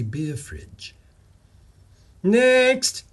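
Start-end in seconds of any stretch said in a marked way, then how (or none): 2.2-3.0 loud, high, braced call of next; a grim, bold call to the next candidate. Acted. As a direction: call it out like bracing for impact. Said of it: "Tracy called, with the grim bravado".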